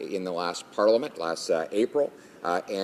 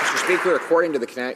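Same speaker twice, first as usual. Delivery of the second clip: high, loud over heckling; jeered in parliament; 8 semitones higher, 5 dB louder, much faster than usual. Real, not acted. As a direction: raise your voice above the jeers. Member starts over noisy chamber.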